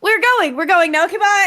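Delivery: high, loud rushed exit; hurried high goodbye. Acted. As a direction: hurry out, flustered and loud. Hurried escape from party.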